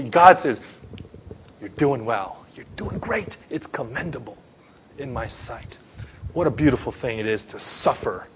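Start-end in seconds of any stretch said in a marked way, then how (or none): 1.6-5.8 soft, tender divine praise; hushed reassurance from God; gentle God voice. Acted. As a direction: speak it like a loving father comforting. God's voice praising the sufferer.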